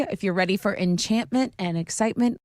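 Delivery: upbeat radio ad read. Acted. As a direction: sell the festival with energy. Host reads a festival ad.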